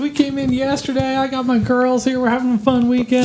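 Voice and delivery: put-on Texas accent. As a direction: Texas accent, chatting up strangers in line. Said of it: "Flawless Texas accent".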